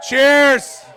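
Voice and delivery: shouted high and loud cheers; loud toast call. Real, not acted. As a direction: raise the glass and call it out. Closing toast, calling out to room.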